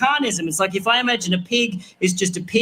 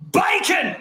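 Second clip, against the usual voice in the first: heckler yelling; 9 semitones higher than usual. Retold or imitated. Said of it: "a man in the audience yelled out".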